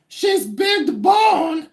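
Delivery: very high, slow shouted punchline; Judy Tenuta shout. Retold or imitated. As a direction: belt it out stretched and shrieking. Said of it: "And then she'd be like".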